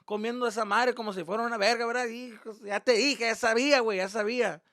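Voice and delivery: high, loud gloating dad imitation; mocking dad. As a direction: crow in Spanish at a caught son. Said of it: "He's just like".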